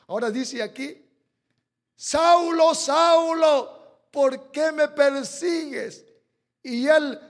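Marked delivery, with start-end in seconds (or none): 2.0-6.1 loud, higher calling voice; booming voice of God. Acted. The Lord calling to Saul, booming. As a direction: call down from heaven.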